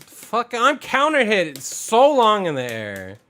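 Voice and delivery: frustrated. Real, not acted. Frustrated after getting combo'd.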